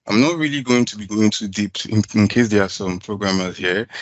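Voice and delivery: usual speaking voice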